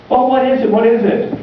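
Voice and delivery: high, eager plea; desperate, high-pitched questioning; anxious pleading. Acted. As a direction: beg to know, pitched well up. Desperate chief pleads for his life.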